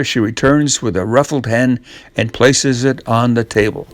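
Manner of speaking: usual speaking voice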